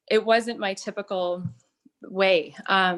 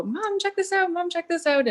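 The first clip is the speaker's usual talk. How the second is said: put-on high, excited kid calling for mom; a kid pulling at mom's sleeve, high and eager; child's voice; 11 semitones higher than usual. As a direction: imitate the son's eager high voice.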